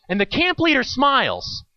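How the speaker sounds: ordinary talk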